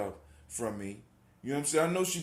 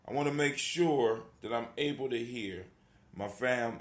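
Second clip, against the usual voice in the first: ordinary talk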